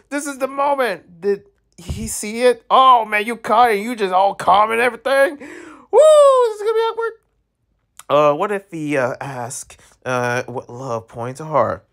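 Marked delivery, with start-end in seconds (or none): 0.1-7.1 high, breathless excited commentary; giddy anticipation. Real, not acted. Streamer watching the game couple almost caught, giddy. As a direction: hold the giddy squeal through the tension. Tense romance moment in game.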